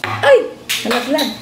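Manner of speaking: sudden high yelp of surprise as the mic falls; oops, it dropped, blurt it out; real startled exclamation; startled cry. Real, not acted.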